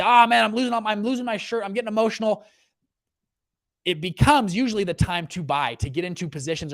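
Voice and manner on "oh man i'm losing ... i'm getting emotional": imitating a panicking investor who lost his shirt; panicked investor. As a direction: play the investor losing his nerve. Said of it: "It's not the time to say".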